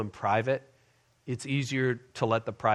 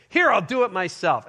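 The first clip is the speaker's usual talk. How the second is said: retold irritated outburst, pitched up; mildly agitated husband; 6 semitones higher, 4 dB louder than usual. Husband grabbing the phone from wife, mildly irritated. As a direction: take over with a touch of temper. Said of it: "being the agitated dad, you know, husband".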